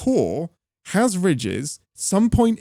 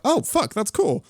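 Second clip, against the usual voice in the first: mock surprise; 8 semitones higher than usual. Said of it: "And I was like".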